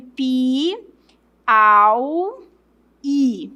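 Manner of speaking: "pi, au, i" as isolated syllables with pauses; slow teacherly spelling-out of "pi, au, i"; syllable separation. Shown as a demonstration. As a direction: say "pi, au, i" one drawn-out piece at a time. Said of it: "Vamos fazer a separação de".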